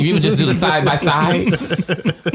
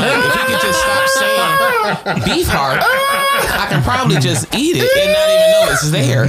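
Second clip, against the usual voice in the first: high, laughing outburst; slower than usual. Real, not acted. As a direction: burst out laughing while saying it. Laughing rant about beef heart.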